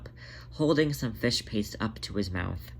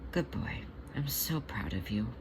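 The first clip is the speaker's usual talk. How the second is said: tender praise; 9 dB quieter than usual. Acted. Jenny praising Monk tenderly.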